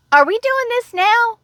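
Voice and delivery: high, incredulous inner voice; shocked disbelief. Retold or imitated. To a coworker demanding a greeting, shocked high disbelief. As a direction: pitch it way up in disbelief. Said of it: "I'm in shock".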